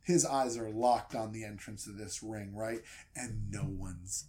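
usual speaking voice

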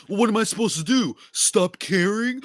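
mock a sulking fan pleading his case; mocking whiny fan. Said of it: "They're always like".

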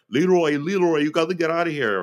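high, loud, urgent mock Belgian accent. De Bruyne warning Leroy to get away, hammed-up Belgian accent. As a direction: call out in your best attempt at a Belgian accent. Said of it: "that's my best Belgian accent".

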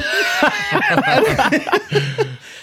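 loud high burst of laughter; hearty high laugh; big laugh. Real, not acted. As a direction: crack up loudly. Laughing at his own story.

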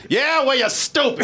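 exaggerated dismissive jeer; mocking retort. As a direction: parody a childish insult, dragging it out. Parodies friend's rude reply to Martin.

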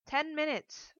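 loud, high, final reply; firm shout back. A narrator's reading. Daughter sets mother a limit through door, firm shout. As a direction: shout it firmly, allowing no argument. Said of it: "I shouted back firmly".

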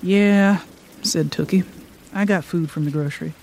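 calm, measured reading aloud; story narration. A narrator's reading. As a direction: read it like a storyteller. Author reads her story aloud.

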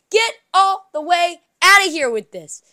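"Get all the way outta here with this" said loud, falling hard on outta; exasperated loud brush-off; fed-up dismissal. Real, not acted. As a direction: wave the whole idea away, loud. Fed-up rant, repeated.

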